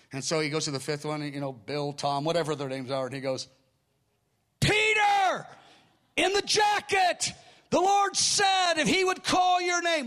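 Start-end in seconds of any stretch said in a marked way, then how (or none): 4.6-10.1 loud, high shout across a hall; calling out. Retold or imitated. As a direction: call him out to the whole crowd, slowly. Preacher calls out man in crowd.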